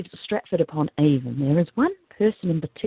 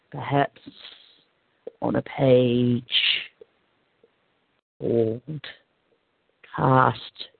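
drawn-out, lower hint; slow, heavy hint; 6 semitones lower, much slower than usual. Real, not acted. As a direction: spell it out word by word. Spelling out a hint slowly.

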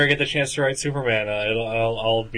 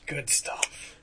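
soft whispered approval; 3 semitones higher, 15 dB quieter than usual. Acted. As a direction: whisper it back. Joining the whispered bit.

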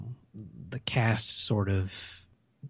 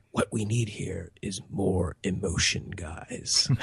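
hushed, breathy movie-trailer narrator voice; trailer man voice; 4 semitones lower, 6 dB quieter, slower than usual. Retold or imitated. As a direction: whisper it like a dramatic trailer voiceover. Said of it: "Talking like the trailer man".

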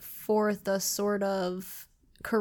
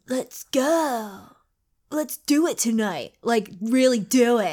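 lightly voiced surfer dude; surfer stoner voice; 3 dB louder, slower than usual. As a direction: play a laid-back stoner. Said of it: "sounded like surfer stoners".

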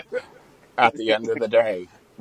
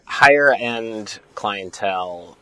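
ordinary talk, nothing marked

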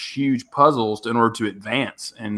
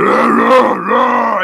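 loud, high growled grunt; imitated Marine grunt; 10 semitones higher, 7 dB louder, much slower than usual. Neighbor mimicking the Marine through the wall, loud grunting. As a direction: bark out a mock Marine war cry. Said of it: "I just hear him in that grunt".

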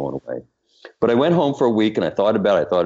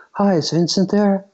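very high-pitched imitated phone caller; 13 semitones higher than usual. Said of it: "I hear this voice, and he goes".

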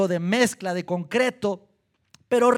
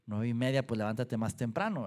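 low, soft mock retort; dry retort; 9 semitones lower, 8 dB quieter, faster than usual. Listener answering a late riser's excuse, low dry retort. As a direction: drop low and quiet, like an aside.

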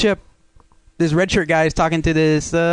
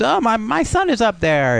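whiny; 8 semitones higher, slower than usual. Retold or imitated. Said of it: "Crusher whines".

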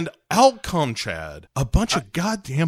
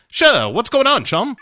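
high, hammy chummy American villain; hearty cartoon colonel, pitched way up; Colonel Moon; 8 semitones higher than usual. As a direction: answer breezily in a high mock-American voice. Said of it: "he's like".

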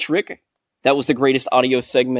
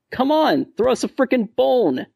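exasperated plea; 12 semitones higher than usual. Acted. Listener's email pleading with the screen, exasperated. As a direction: beg in exasperation, pitched well up.

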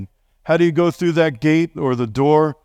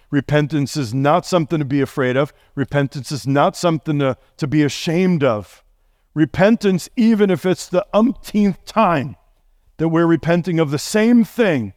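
ordinary talk, nothing marked

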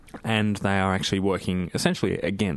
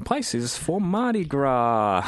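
slow, hammed-up radio announcer; 6 semitones higher, slower than usual. Shown as a demonstration. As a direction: stretch out "Mardi Gras" like an announcer. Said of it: "I just put my announcer voice on".

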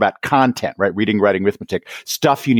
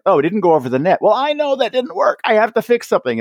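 mock tennis player; 9 semitones higher, faster than usual.

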